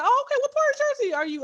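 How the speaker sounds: very high, quick friendly question; bubbly polite chatter. Retold or imitated. Making small talk with [name]: very high chirpy voice. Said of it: "I said".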